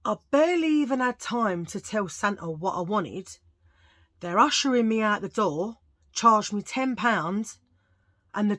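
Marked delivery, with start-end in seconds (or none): none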